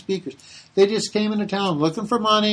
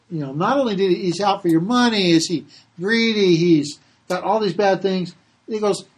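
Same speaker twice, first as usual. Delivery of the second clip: ordinary talk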